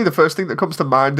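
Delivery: ordinary delivery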